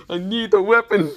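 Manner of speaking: high, loud demand; insistent yell. Acted. Hero working out what he needs, loud insistent yell. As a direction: push the need out high and hard.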